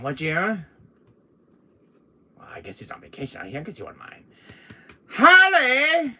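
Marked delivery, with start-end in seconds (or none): 5.1-6.2 high, loud call across the room. Acted. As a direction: shout her name to get her attention. Calling out.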